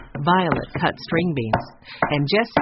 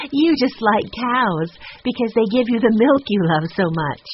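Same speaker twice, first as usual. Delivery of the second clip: higher, louder line through laughter; laughing; 6 semitones higher, 5 dB louder than usual. A narrator's reading. Girl teasing her little brother, laughing. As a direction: tease with a laugh in the voice.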